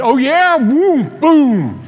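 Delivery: loud gleeful boom sounds; hammed-up victory cry; mock triumphant boom. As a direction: blast it like lobbing grenades. Triumphant retold counterattack.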